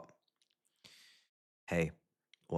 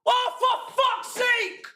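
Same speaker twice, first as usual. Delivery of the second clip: furious shout; 12 semitones higher, slower than usual. Real, not acted. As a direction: yell in fury at the decision. Goal disallowed, fury.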